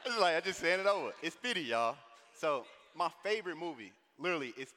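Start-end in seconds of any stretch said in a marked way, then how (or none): 0.0-2.0 laughing. Real, not acted. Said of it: "laughs while telling it".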